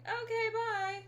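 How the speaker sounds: high, loud re-enacted farewell; mock-cheery high goodbye; excited goodbye. Acting out own goodbye to child.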